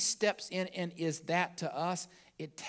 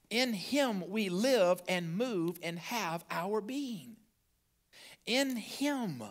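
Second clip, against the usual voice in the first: slow, lifted preaching voice; 4 semitones higher, much slower than usual. Acted. Pastor proclaims scripture to the congregation, slow and lifted. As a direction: draw the words out to the church.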